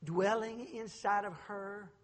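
ordinary talk

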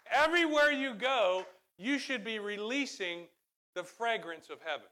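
high, loud pulpit proclamation easing at the end; fervent preaching. Real, not acted. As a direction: proclaim it to the whole church. Preaches at full force.

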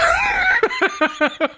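high shrieking burst of laughter; big laugh. Real, not acted. Cracking up at the pizza joke, a high shriek of laughter.

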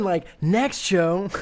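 high, slow mock "next show"; sing-song imitation; mock promise. Mocking the host's promise.

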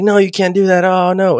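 a touch of frantic worry; mock alarm. [name] begging her son not to go, mock panic.

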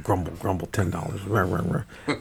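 low, quiet grumbling mutter; begrudging grumble. Retold or imitated. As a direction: grumble it like a reluctant cheapskate.

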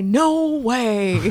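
loud, drawn-out mock yell; hammed-up shouted denial; yelled reply. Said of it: "I yell it out".

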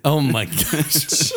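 laughing through words. Real, not acted. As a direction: crack up, drawn out. Laughing reaction.

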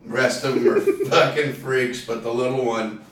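ordinary talk

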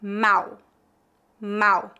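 Mal with an open A and a final L sounding like u. Shown as a demonstration. Teacher models Mal for learners, open A. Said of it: "feita com A aberto".

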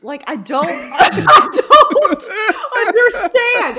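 loud, high, cracking up mid-sentence; exasperated rant breaking into laughter; laughing exasperation. Real, not acted. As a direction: wail it through helpless laughter.

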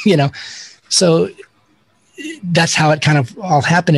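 ordinary talk

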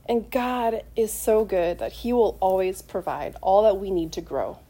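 ordinary talk

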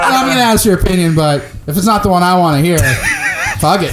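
rushed mock defiance.

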